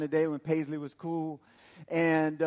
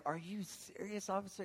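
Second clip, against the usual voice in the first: hushed disbelief; 12 dB quieter than usual. Retold or imitated. Arrested driver questioning the officer, hushed disbelief.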